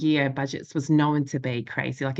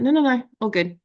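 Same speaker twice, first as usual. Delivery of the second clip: higher, louder, airy dismissal; breezy brush-off; 8 semitones higher, 5 dB louder than usual. Retold or imitated. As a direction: wave him off lightly, all is fine. Brushing off husband's bedtime warning.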